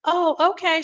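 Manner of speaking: put-on high, sweet elderly auntie greeting; a fond imitation of a hundred-and-five-year-old aunt, high. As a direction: lift the voice up into a frail old auntie. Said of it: "she'll be like".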